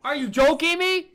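high, loud exasperated yell; incredulous shouting; outraged disbelief. Real, not acted. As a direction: shout it at an unfair game. Game throws him back.

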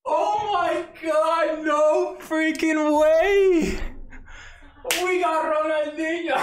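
high, breathless, slow disbelief; can't believe it, slowly; stunned disbelief. Real, not acted. Streamer staring at Ronaldinho in the pack, stunned.

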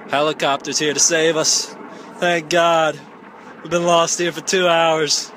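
loud, real relief over the helicopter noise; relieved shouting. Real, not acted. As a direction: shout your thanks as rescue lands. Rescue helicopter arrives.

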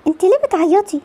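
normal talking voice

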